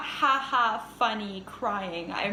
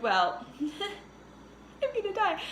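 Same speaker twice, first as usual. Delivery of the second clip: laughing despair; 9 semitones higher, much slower than usual. Retold or imitated. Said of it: "And I was like".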